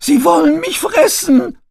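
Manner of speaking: very high, loud panicked peep; peeping baby chick. A narrator's reading. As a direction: shriek it like terrified chicks. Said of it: "piepste es mehrstimmig".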